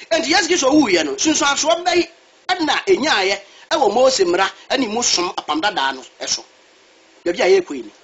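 ordinary talk

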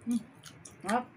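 speaking between bites; talking while chewing. Real, not acted. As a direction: mouth partly full of food. Seen 0: bites chicken at -5, jaw working and lips pursed while speaking.

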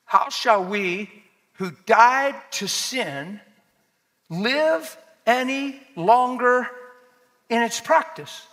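emphatic preaching. Acted. Preacher reading Romans to the church, emphatic. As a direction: declaim each phrase slowly and weightily.

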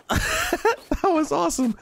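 laughing. Real, not acted. Laughing after sled ride.

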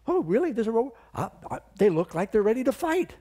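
put-on a scoffing young challenger; surprised, high and loud; incredulous David. David's audacious reply quoted.

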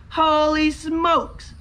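high, slow-stretched whoop; amazed exclamation. Real, not acted. Scratcher has back-to-back wins, amazed whoop. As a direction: draw it out high in wonder.

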